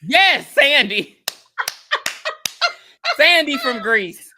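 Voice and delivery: high, loud, slow laughing fix; laughing correction. Real, not acted. Correcting a wrong movie name while laughing. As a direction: laugh at your own mistake. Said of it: "correcting herself, laughing".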